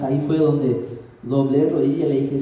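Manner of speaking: usual speaking voice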